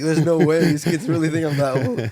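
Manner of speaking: mock outrage at being thought old; playfully offended disbelief; incredulous inner thought. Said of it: "I was like".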